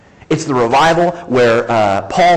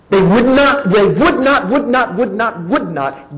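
louder, pounding each would not; hammering emphasis; 7 semitones higher, 3 dB louder, slower than usual. Acted. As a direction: drive would not home again and again. Preacher hammers repeated phrase.

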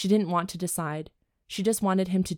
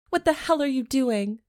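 squealing read; 7 semitones higher, 4 dB louder than usual. Shown as a demonstration. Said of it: "Was that a good delivery?".

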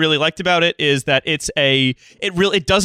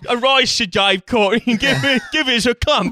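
mock knighting; 5 semitones higher than usual. Mock knighting of Sir Dave Courtney.

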